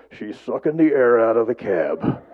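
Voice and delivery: a hint of Jim deadpanning on the phone; lightly voiced Jim joking about his passenger; Jim's deep voice. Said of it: "he has a deep voice".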